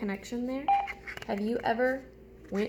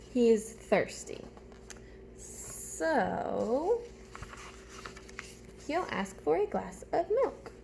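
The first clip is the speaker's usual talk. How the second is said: put-on slow, lilting storybook read for kids; animated storytime voice for young listeners; reading a picture book aloud; 2 semitones higher than usual. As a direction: read it like story time with little ones. Reads picture book to class.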